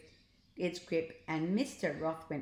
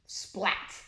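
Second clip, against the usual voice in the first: splat noise. A narrator's reading. Landing sound effect.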